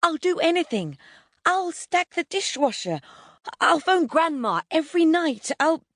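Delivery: very high, desperate bargaining; wild pleading. A narrator's reading. Son bargaining with mum for his computer, desperate. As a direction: scrabble wildly for promises. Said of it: "he casts about wildly".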